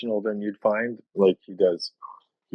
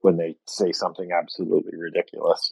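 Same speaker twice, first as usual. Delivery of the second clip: normal talking voice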